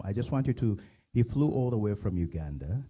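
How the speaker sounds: usual speaking voice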